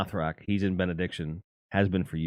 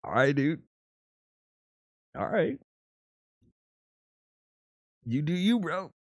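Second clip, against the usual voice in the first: mock humoring; 8 semitones higher than usual. Fan shrugging at the singer, indulgent and higher. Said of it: "you're like".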